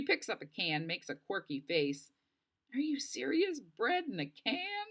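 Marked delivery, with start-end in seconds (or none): 2.7-4.9 put-on a girl amused and doubtful at bread in a can; incredulous. Delphi at canned bread.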